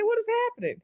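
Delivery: very high, soft squeal of shock; shocked disbelief. Retold or imitated. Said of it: "I said".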